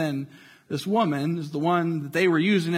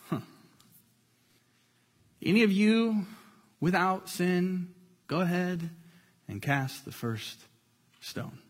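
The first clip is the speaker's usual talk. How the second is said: much softer, fading almost to nothing; hushed and calm; 6 dB quieter than usual. Acted. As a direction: let each word drop quietly, unhurried. Jesus answers the accusers calmly.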